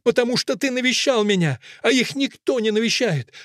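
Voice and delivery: high-pitched insistent cry; Fusia. A narrator's reading. Fusia reminds Akelina of his visits, crying out high. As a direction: argue in a lightly shouted voice. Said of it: "кричит Фуся".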